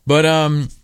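usual speaking voice

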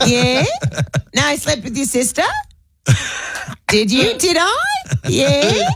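volley yes and no as questions; Australian uptalk. Retold or imitated. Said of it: "they go up at the end of a sentence".

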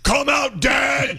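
high-pitched calling out; hammed-up villager's shout; mock mob summons. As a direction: summon him from outside the castle. Mob calling at castle door.

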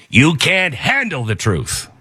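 slow, lightly done movie-star growl; a hint of Nicholson's courtroom line, slow; Jack Nicholson impression. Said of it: "I'm turning into Jack Nicholson".

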